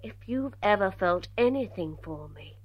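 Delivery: soft, low plea; quiet pleading. Acted. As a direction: beg him softly to leave. Begging her father to leave.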